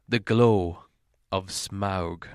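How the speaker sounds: ordinary delivery, nothing marked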